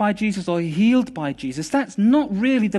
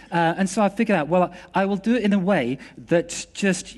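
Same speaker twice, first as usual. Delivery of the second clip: ordinary delivery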